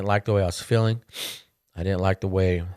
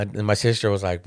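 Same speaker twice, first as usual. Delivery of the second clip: usual speaking voice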